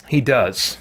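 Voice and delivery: ordinary delivery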